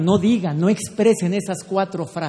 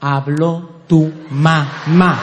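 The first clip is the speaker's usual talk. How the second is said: Habló tu mamá said very slowly, lower, each word laid down; slow and calm; 3 semitones lower, much slower than usual. Shown as a demonstration. Showing the ladies how to tell a husband Habló tu mamá, slow and calm. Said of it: "Al hombre se le habla así".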